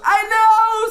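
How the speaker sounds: very high, loud panicked shout; screamed reply. A narrator's reading. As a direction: scream back at him. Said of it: "boys screamed even louder".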